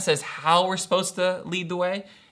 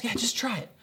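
soft, higher-pitched coaxing; gentle encouraging; 4 semitones higher, 6 dB quieter than usual. Retold or imitated. Dad re-enacting coaxing his toddler, softly encouraging. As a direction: nudge a small child softly to have a go. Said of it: "And I'm encouraging, I'm urging him".

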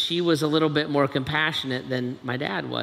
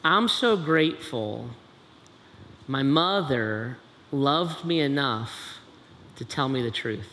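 solemn heartfelt preaching. Real, not acted. Preacher telling the congregation his gratitude for his mother's truth, solemn preaching. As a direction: weigh each phrase, letting the endings drop away. Solemn, emphatic reflection after the story.

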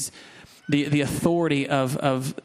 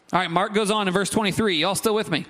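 ordinary talk

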